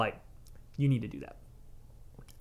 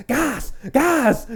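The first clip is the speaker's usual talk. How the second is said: panicked call; 11 semitones higher, 4 dB louder than usual. Retold or imitated. Artist panicking as client collapses.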